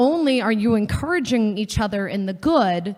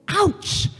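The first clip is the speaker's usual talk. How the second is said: mock ouch; 11 semitones higher than usual. Reacting to hurtful remark.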